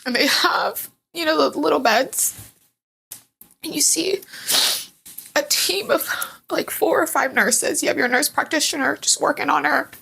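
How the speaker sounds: high, tearful voice with long pauses; choked up. Real, not acted. Mother recalling her baby not breathing, tearful and halting. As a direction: fight back real tears while telling it.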